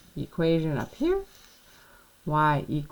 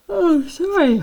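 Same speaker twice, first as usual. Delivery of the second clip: yawning; 13 semitones higher, 9 dB louder than usual. Real, not acted. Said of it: "I'm yawning now".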